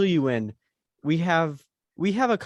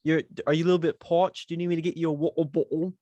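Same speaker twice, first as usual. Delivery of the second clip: lightly put-on British accent, a bit higher; mock British accent; 3 semitones higher, faster than usual. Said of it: "You're British".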